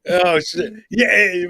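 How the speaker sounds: ordinary talk, nothing marked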